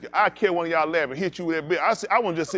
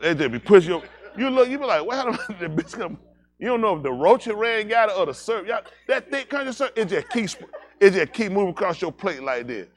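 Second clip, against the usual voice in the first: talking through laughter, louder and higher; laughing; 6 semitones higher, 7 dB louder than usual. Real, not acted. Comic cracking up at his own syrup story, laughing. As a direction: laugh while telling it.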